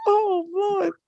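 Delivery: high laughing cry of disbelief; real laughing outburst. Real, not acted. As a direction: crack up while calling on the Lord. Teller cracking up at story.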